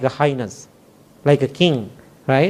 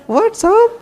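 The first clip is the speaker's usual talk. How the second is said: squeaky, much higher and louder "What's up?"; startled echo; 3 dB louder than usual. Retold or imitated. Monk echoing the stranger's greeting, baffled and squeaky. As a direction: echo it back in baffled surprise.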